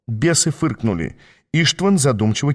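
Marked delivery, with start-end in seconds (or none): none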